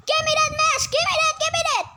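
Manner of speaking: high, loud panicky grab; panicked demanding. Acted. Grabbing oxygen mask.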